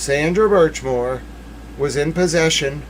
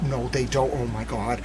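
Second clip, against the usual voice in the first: much softer, almost to herself; stunned hush; 3 semitones lower, 8 dB quieter than usual. Real, not acted. Streamer to chat, hushed and stunned. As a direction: drop the voice in disbelief.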